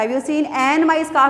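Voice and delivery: very slow, showing how odd it sounds; slow wrong example. Shown as a demonstration. Teacher slowly testing a wrong article. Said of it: "भी नहीं आ सकता".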